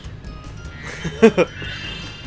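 breathy amused laugh; airy laugh. Real, not acted. Amused by pigs.

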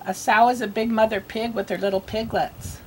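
usual speaking voice

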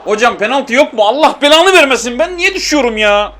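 very high, loud indignant complaint; outraged protest. Real, not acted. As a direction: plead with the ref in outrage. Protests no penalty given.

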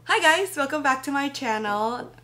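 high, loud, bubbly greeting; chirpy vlog intro. Shown as a demonstration. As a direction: greet viewers like a YouTuber. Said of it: "I cannot do this".

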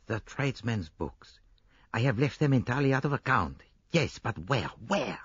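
lightly voiced detective musing aloud, then decided; thinking Poirot. Poirot ignoring Hastings while working it out, musing then resolute.

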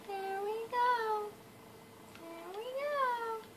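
very high, soft little tune; high singsong. Real, not acted. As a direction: sing it softly way up high. Singsong to self while building.

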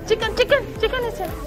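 usual speaking voice

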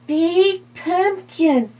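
high, slow little bat voice; squeaky bat. Acted. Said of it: "said the bat".

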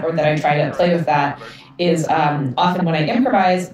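usual speaking voice

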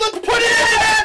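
slow, high, loud urging; excited shout. Real, not acted. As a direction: roar the team on. Urging the penalty.

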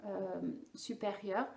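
usual speaking voice

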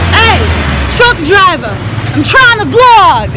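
loud call to the truck driver, slowing on the last words. Real, not acted. Vlogger yells at the honking truck driver, loud and annoyed. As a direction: yell across the street over traffic.

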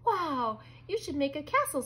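exclaim, then eagerly suggest; amazed. A narrator's reading. Brother to sister after the magic, amazed.

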